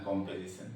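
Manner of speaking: low, soft कॉम्पोजिशन with "pe" for "po"; weak form. Shown as a demonstration. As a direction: repeat कॉम्पोजिशन quietly as "kompezisan". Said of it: "की जगह ये बोलेगा".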